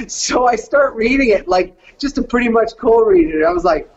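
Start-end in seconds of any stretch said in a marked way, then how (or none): none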